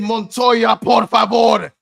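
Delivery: mock Spanish, loud and high; imagined Trent. Host voicing what the player really thought, in loud mock Spanish. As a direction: belt out a playful Spanish plea. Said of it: "This brother was really thinking".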